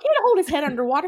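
hammed-up horrified no-no-no; mock panicked shriek. Eleanor forbidding head under water, mock panicked shriek. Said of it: "She's like".

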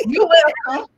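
high, loud joke through laughter; laughing tease. Retold or imitated. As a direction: crow it playfully, cracking up. Joking retold reply to mom.

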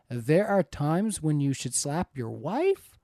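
slow, questioning rise on slap your wife; emphatic question. Real, not acted. Host reading a viewer's message about slapping, emphatic question. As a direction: read it as a drawn-out question, lifting at the end. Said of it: "I put the emphasis on there to show that there's a question mark".

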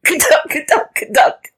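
high, loud croaky cackle noises; frog laughing. A narrator's reading. Frog laughing aloud at Rabbit, high cackle. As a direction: imitate a frog's laugh. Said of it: "the frog laughed aloud".

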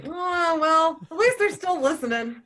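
high, loud, laughing mock comeback; laughing retort. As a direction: toss it off with a laugh, lightly. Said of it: "And I was like".

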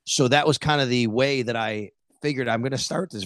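ordinary talk, nothing marked

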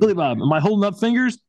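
high, loud, fast trainer yelling; mock trainer shout. Trainer yelling at Billy Bob on the sideline, high and loud. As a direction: bark the question at the dazed player, high and fast.

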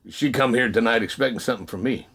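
understated quiet voice from above; lightly voiced God. The voice speaks to the man in church, lightly voiced God.

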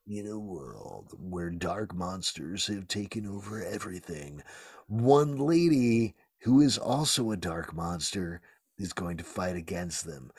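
deep dramatic movie trailer read; horror trailer voice. Acted. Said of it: "Good voice".

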